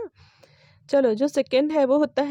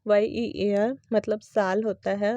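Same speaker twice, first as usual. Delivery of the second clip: ordinary talk, nothing marked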